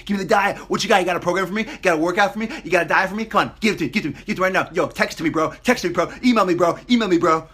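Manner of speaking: loud, high, pumped-up rant; new gym member bragging he is ready, loud and pumped; overexcited gym newbie. Retold or imitated. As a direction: hype yourself up like a gym newbie. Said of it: "really excited are the people".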